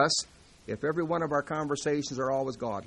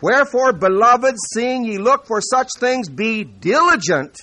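loud, high, slow preacher reading scripture; fiery pulpit proclamation, louder and higher; full-force preaching; 7 semitones higher, 12 dB louder than usual. Acted. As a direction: thunder out the verses to the congregation.

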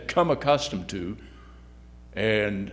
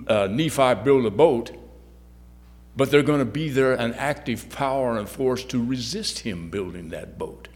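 usual speaking voice

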